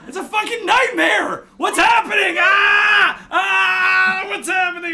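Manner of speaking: high, loud panicked yelling; screaming as the meat goes overboard; panicked shout. Real, not acted. Friends in a kitchen mishap, panicked high shouting.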